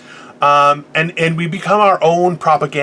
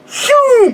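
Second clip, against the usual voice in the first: high relieved phew; 4 dB louder than usual. Retold or imitated. Relief sound.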